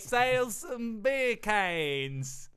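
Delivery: hammed-up Aussie voice, slow; a terrible mock Australian; bad Australian accent. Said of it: "That's terrible".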